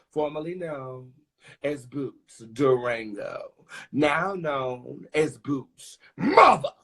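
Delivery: slow, grand announcer voice; drag name announcement. Acted.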